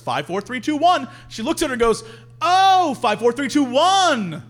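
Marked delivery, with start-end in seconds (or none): 2.4-4.4 loud, high OH, then slower digits; mock dawning understanding, drawn out; sudden realization. Woman finally getting the bathroom code, exaggerated realization.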